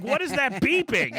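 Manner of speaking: high, slow nagging voice; lightly voiced puzzled mother-in-law. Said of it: "She's like".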